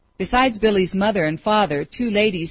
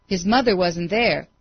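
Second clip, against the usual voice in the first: plain reading voice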